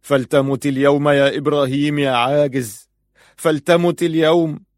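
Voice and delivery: high, loud curse at himself; full-voiced desperate yell; screaming at the top. A narrator's reading. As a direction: shout it out in anguish. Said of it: "صرخ بأعلى صوته".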